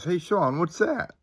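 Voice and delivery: high-pitched call, rising at the end; sister calling. Retold or imitated. Sister to brother in a dark graveyard, high questioning call.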